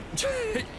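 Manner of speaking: short high awkward laugh; nervous laugh. Acted. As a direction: laugh it off uneasily.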